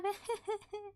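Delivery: short high-pitched giggle; quick burst of real laughter; soft, high chuckle. Real, not acted. Laughing at the game.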